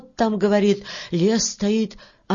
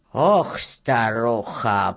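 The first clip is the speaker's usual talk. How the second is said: put-on low, slow reproachful old voice; grey-haired little man; 9 semitones lower, much slower than usual. Grey-haired worker reproaches the beetle, disapproving. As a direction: drop deep and draw out the sigh. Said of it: "покачал головой седой мужичок".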